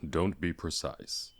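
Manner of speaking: slow gruff dwarf retort; Dwalin dry. Acted. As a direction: drawl it dryly back. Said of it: "said Dwalin".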